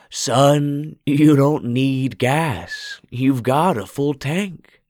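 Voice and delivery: creepy shopkeeper calls a greeting; hoarse old man calling. A narrator's reading.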